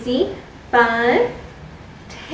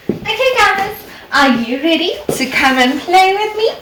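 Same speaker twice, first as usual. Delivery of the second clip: excited; 8 semitones higher, 4 dB louder than usual. Acted. Teacher calling the children to play.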